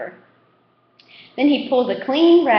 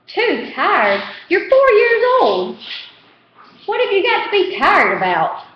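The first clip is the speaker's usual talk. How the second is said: high, louder incredulous scolding; scolding aunt; 10 semitones higher, 4 dB louder than usual. A narrator's reading. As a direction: scold the boy in disbelief. Aunt scolds lazy boy.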